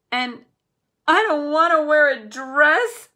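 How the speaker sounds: high, loud sobbing plea. A narrator's reading. Nate sobbing to Dad about the dress, loud and high. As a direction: sob it through tears. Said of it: "sobbed Nate".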